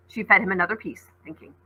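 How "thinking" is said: plain reading voice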